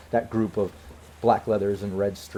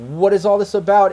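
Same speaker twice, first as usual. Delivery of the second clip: higher, louder woman's voice demanding answers; raised female character voice; 8 semitones higher, 7 dB louder than usual. Acted. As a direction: call it out as a woman stepping in. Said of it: "you hear a female voice like".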